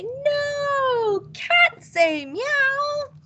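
put-on high, slow children's chorus; imitating children shouting the answer; kids answering. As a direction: answer like an eager child, drawn out. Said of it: "the kids always love to say".

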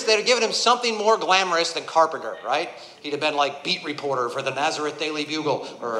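ordinary talk, nothing marked

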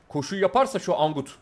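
usual speaking voice

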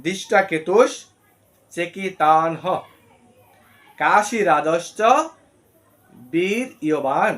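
slow word-by-word Sanskrit, each word set apart; verse broken into words. Shown as a demonstration. Teacher breaking the verse into words for viewers. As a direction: pause after every word of the verse. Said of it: "আমরা যদি এই শ্লোকটিকে ভাঙি".

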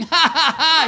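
high, loud-ish "ha, ha, ha" imitation; mock gorilla laugh. Gorilla running from the lion, mock laughing. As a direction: act out a gorilla's taunting laugh. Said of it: "The gorilla's laughing".

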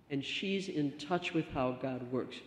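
usual speaking voice

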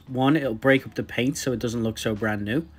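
ordinary talk, nothing marked

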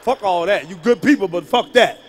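mock furious boyfriend; angry. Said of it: "Now I'm getting angry now".

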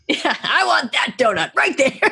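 high, amused donut demand; laughing mock demand. Echoing a co-host's quote of the donut line, laughing.

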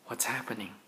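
hushed, breathless what's happening; worried whisper. Acted. Dad reading to his kids, whispering in mock fright. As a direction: whisper it as if scared of the storm. Scared puppy moment whispered.

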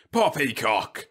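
mild roar of dismissal. A narrator's reading. General interrupting Whitlow's objection, roaring. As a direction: cut in with a roar. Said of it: "the general roared".

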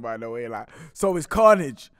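usual speaking voice

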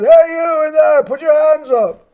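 loud, high shouted order; police command shout. Retold or imitated. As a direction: shout like a cop giving a command from outside. Retold police officer shouting an order.

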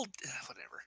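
soft unvoiced whisper. Real, not acted. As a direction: breathe it like an aside. Muttered aside after a fall.

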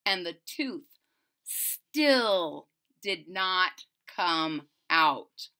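ordinary delivery

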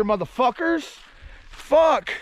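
usual speaking voice

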